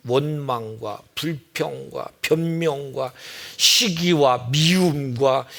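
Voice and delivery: slow, heavy, each sin weighed out; slow solemn list of sins. Acted. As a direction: drag out every sin on the list. Listing sins slowly.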